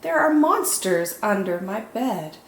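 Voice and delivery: ordinary delivery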